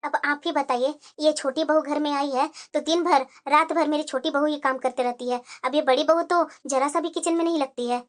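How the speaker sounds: put-on an older mother-in-law, speaking low and soft to her bahu; mother-in-law answering her daughter-in-law, low and soft. Mother-in-law complaining to husband.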